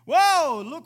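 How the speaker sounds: high, very loud whoop; joyful shout. Pretend. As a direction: shout woo for joy. Bold believer shouting for joy.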